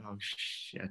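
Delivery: hushed dread. Retold or imitated. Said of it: "I was like".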